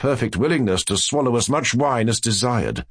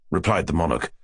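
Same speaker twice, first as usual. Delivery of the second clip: plain reading voice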